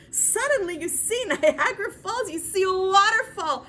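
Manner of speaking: loud, high burst of wonder; awestruck excitement. Acted. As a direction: erupt in amazement at the sight. Awestruck at imagined waterfall.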